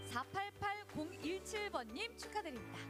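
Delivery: high, bright winner reveal; booming prize announcer. Real, not acted. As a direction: call out the winner with flair. Live prize draw winner reveal.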